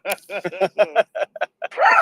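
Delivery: a high, loud cackling laugh; evil laugh. Real, not acted. Friend cracks up, an evil-sounding cackle. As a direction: let out a wicked cackle. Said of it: "It's like an evil laugh".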